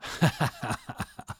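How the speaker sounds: breathy triumphant laugh; knowing laugh. Retold or imitated. Captive laughs at the killers holding him. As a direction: laugh at the gangsters. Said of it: "Colin's just laughing".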